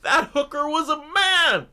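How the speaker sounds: high, laughing exclamation; cracking up mid-sentence; laughing outburst. Real, not acted. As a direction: blurt it out through laughter. Laughing outburst about the plot.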